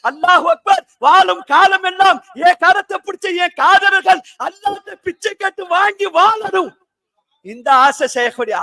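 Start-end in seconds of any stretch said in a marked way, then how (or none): 0.1-6.7 raised, ringing sermon delivery; fervent preaching. Real, not acted. Preacher extolling the sheikh to the gathering, fervently. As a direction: preach it out with full force.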